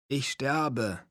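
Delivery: ein Hauch von Gejammer; kränkelnd ins Telefon klagen; leicht jammernd. Acted. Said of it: "jammerte ich". (kranker Erzähler jammert leise am Telefon)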